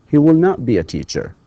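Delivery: He will not be a teacher, will not stressed, falling; teacher modelling the negative. Shown as a demonstration. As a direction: land hard on the negative. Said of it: "negative drill".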